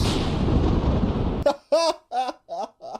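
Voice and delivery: a high falling laugh; real laughter. Real, not acted. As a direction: laugh at your own joke. Laughing at own story.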